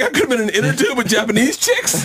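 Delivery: high, laughing mock grumble; laughing retold gripe; laughing complaint.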